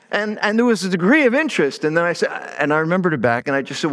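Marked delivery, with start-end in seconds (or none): none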